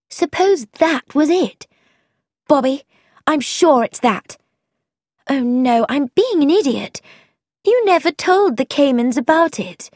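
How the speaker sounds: put-on excited young woman catching on; eager Frankie working it out. Frankie voiced, excited realisation.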